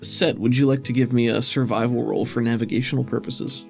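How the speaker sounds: ordinary talk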